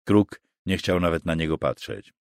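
ordinary delivery, nothing marked

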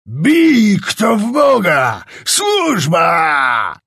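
high, loud, slow cry for help; shouting. A narrator's reading. Czapliński calling his servants for help, loud panicked shout. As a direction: bellow for the servants. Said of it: "krzyknął Czapliński".

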